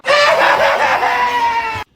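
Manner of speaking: put-on high, loud little-boy cackle; bratty kid laugh. Billy running to his room, loud high laugh. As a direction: laugh as you run off.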